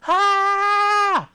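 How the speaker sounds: very high, loud imitated shriek; loud scream. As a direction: let out a piercing startled scream.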